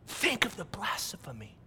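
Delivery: whispered, almost breathless aside; hushed whisper. Real, not acted. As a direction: drop to a whisper to let it sink in.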